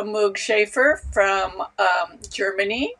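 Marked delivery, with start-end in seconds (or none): none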